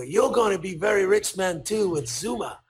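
emphatic put-on Japanese accent. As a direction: proclaim it like a Japanese businessman, imitated accent. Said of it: "here's my Japanese accent".

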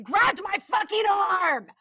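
reading the grab with outrage; outraged emphasis. Real, not acted. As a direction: slam the shocking part. Reading shocking story part.

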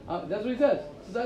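ordinary talk

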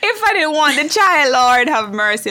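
high, loud plea to heaven; aghast exclamation. Real, not acted. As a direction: cry out aghast, pitched well up and loud. Exclaims at the same imagined ordeal.

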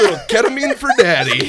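high, loud, laughing delivery; mock carefree parent. Joking about how his parenting would be, mock carefree parent. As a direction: toss it out as a merrily reckless daddy.

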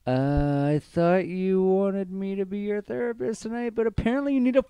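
high, sustained incredulous retort; mock exasperation. Bartender answering a customer's toilet complaint, mock-exasperated. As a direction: push the pitch way up in mock disbelief.

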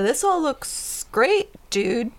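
slow, high, laid-back dude; player character voice. Acted. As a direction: drawl it out like a chill dude. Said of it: "Maris says".

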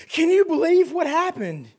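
high, slow, exaggerated gasp of disbelief; mock shock. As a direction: parody people acting shocked. Mimicking shocked believers.

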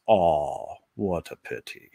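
imitating a German father-in-law; light German accent, a little low. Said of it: "in his broken English".

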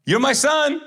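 high, loud proud-dad shout; calling out loud. Acted. Dad announcing his son to everyone, high loud call. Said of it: "he says it out loud".

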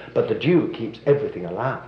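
ordinary talk

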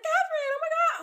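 very high, loud excited squeal. Retold or imitated. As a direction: squeal the name with delight. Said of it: "they were like".

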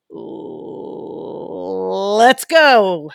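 growly wind-up swelling into a drawn-out holler; J C Groves. Acted. Podcast host summoning a fellow podcaster's catchphrase, growly hype. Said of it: "summon my inner J C Groves".

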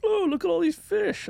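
a high, gushing imitation of a buddy's brag; imitating Gary's excitement. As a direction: ham up a proud fisherman showing off. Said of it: "coming back to the office like".

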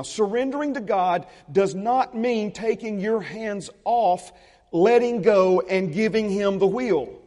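slow, weighty line, every phrase set apart; preacher slowing down to stress the point; slow and deliberate. Shown as a demonstration. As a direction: lay it down slowly so they hear each word. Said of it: "Listen very carefully to this now".

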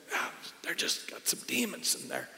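put-on very soft, whispered aside to a child; hushed dad answering his daughter, acted out; retold whisper. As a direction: whisper it back casually, as if in church.

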